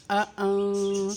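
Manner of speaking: اَأَن with the nun hummed softly through the nose; soft nasal ikhfa. Shown as a demonstration. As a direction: hide the nun gently, nasal hum. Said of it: "ناک میں آواز لے جا کر". (اَأَن، نون کا اخفا ناک سے نرمی کے ساتھ)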